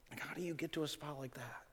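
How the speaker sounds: very soft, almost breathed question; hushed question. Real, not acted. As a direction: drop right down and ask it softly. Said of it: "same question repeated hushed".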